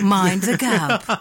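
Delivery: slow, stretched English announcer; metro announcement. Acted. Host completing the metro recording for laughs, slow announcer. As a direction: draw out the safety warning like a recording. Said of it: "να μου ηχογραφήσεις αυτό που λέει".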